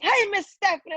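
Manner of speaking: put-on high, sing-song child voice; kids greeting. Schoolkids greeting their favorite liaison, high child voice. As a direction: call out like an eager schoolkid. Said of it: "They just".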